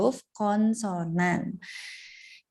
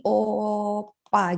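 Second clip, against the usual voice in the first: wrong way, drawn out. Shown as a demonstration. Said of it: "kita nggak bisa nih tulis kayak gini".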